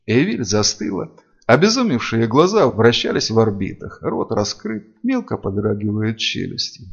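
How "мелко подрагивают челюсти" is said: plain reading voice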